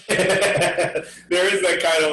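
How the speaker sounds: ordinary talk, nothing marked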